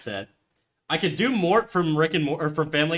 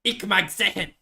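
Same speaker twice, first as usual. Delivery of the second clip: higher-pitched, hammed-up German accent; Hitler-esque German accent; 4 semitones higher than usual. Streamer overdoing German for chat, aggressive German accent. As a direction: put on an over-aggressive mock German. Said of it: "I'm getting too Hitler-esque".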